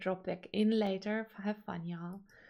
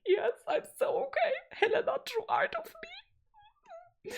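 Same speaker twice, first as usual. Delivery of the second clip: blubbering happy tears, much higher; crying with joy. Retold or imitated. To Bestie on his birthday, high teary happy crying. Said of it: "I was like".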